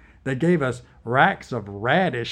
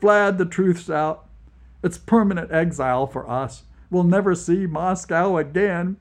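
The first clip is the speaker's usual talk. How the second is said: tearful; 6 semitones higher, 5 dB louder than usual. Acted. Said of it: "Tears filled my eyes".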